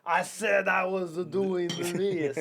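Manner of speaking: slow English in a mock French accent; hammed-up French accent on English. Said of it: "speak English in a French accent".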